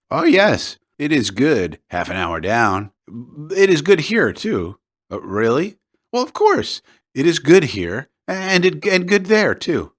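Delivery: a hint of a keen, coaxing local; eager Peduzzi.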